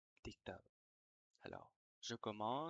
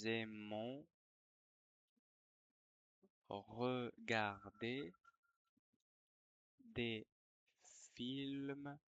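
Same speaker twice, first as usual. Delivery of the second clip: dictating. Shown as a demonstration. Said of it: "je vais dicter une dictée".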